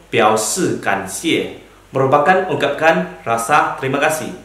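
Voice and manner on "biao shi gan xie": each syllable laid out slowly; slow careful Mandarin. Shown as a demonstration. Teacher introduces topic name to class, slow and clear. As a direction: read the Chinese term slowly for learners. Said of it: "Ketiga yaitu".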